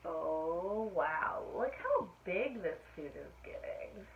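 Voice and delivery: ordinary delivery, nothing marked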